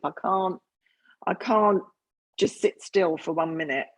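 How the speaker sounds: ordinary talk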